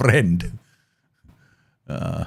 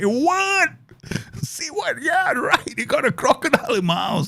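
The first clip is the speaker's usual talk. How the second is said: much higher, incredulous repeats; mock disbelief. Telling a stream his disbelief at his friend's crocodile news, much higher. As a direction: act stunned, pitch way up. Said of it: "I'm like".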